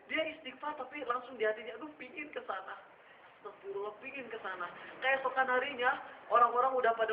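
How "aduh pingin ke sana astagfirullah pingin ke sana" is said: much softer, guilty half-voiced longing; secret inner craving. Young man's heart craving the forbidden, hushed and guilty. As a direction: confess the craving under your breath.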